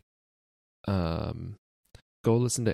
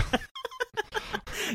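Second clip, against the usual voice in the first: squeaky high laugh; a sudden high-pitched giggle; short high giggle breaking out; 4 dB quieter than usual. Real, not acted. Laughs at own joke.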